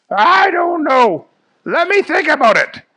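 loud, high cartoon voice; Fish Guy. Stumped fish hero answers Jerry, high and loud.

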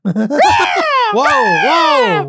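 very high, drawn-out whoa; startled shout. Real, not acted. Reacting to a sudden noise, startled shout. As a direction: cry out in alarm, pitch leaping up.

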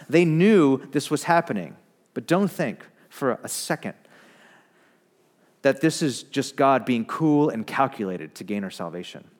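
ordinary delivery

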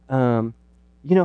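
usual speaking voice

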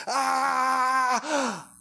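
long high held mock cry; imitated child's wail before calming down; toddler wailing. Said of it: "like he's literally gone from".

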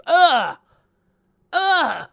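loud high groan of disgust. Retold or imitated. Mocking disgust at the Halo stream. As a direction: groan loudly, fed up.

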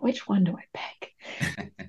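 hushed whisper to herself. Retold or imitated. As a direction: breathe it out as a whisper. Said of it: "I was like".

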